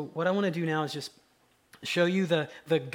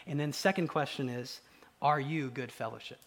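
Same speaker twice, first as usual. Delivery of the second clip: ordinary talk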